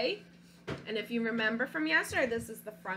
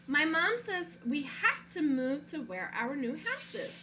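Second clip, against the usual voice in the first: put-on storybook read-aloud, childlike narrator; reading to children; 3 semitones higher than usual. Teacher reading a picture book to her class, storytelling voice. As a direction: read it like a stubborn little boy.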